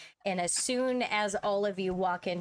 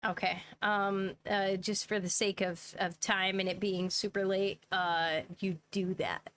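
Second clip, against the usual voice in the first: ordinary delivery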